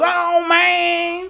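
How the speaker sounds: high mock drunk jive voice. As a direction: imitate drunk Gary's jive talk. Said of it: "he literally talks like that".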